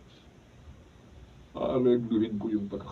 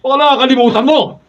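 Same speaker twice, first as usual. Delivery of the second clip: loud, much higher taunting yell; menacing shout; 18 dB louder than usual. Acted. Bully Mulong threatens Oreng with a beating, shouting high. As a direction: sneer and threaten at full volume.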